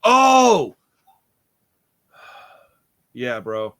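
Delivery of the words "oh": loud, high drawn-out "oh"; shocked cry. Real, not acted. Co-host suddenly recalls the grim duckling scene, shocked cry.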